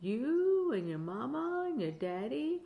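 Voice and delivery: plain reading voice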